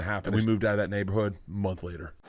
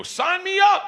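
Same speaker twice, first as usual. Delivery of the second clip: very high, loud squeal; excited yell; 5 dB louder, slower than usual. Retold or imitated. Buyer offered a Desert Eagle, excited yell. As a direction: jump at the offer with an eager shriek. Thrilled buyer accepting big gun.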